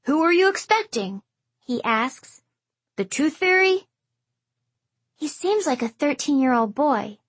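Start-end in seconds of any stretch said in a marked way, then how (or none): 0.0-3.9 higher, snarky grandpa voice; teasing old man. A narrator's reading. Grandfather teases narrator.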